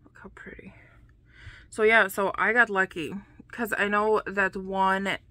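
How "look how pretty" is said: breathy admiring whisper; soft whispered admiration. Real, not acted. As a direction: whisper it in awe.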